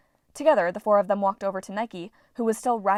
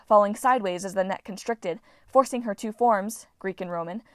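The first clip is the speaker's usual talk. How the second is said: plain reading voice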